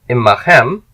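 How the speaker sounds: loud, clear model for students; repeat-after-me model. Shown as a demonstration. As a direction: project the word for the class to echo. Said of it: "just repeat after me".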